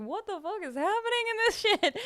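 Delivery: high, incredulous, breaking into a laugh; half-laughing mock outrage at the screen; baffled and laughing. Watching Midsommar together, high incredulous laughing disbelief. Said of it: "We were like".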